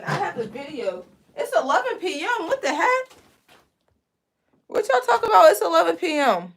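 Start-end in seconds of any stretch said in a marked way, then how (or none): 1.3-6.5 higher-pitched, startled disbelief; incredulous. Real, not acted. Streamer reacting to viewers saying it's eleven P M, incredulous.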